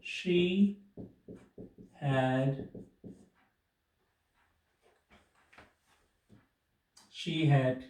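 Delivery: each word spaced out with long pauses; slow word-by-word dictation. Shown as a demonstration. Teacher dictating a new sentence slowly. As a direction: spell out the sentence slowly for students. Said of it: "इसी तरह और बना लेते हैं".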